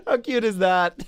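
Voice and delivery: loud and high, laughing; squealing with delight. Real, not acted. Laughing at the dropped sheep.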